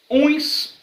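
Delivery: ordinary talk, nothing marked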